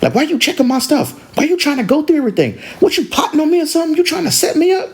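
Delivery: high, quick mock-indignant questions; angry accusation. Angry sister accusing her sister of snooping, indignant. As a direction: pile up accusations like an outraged sister. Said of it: "the angry sister was like".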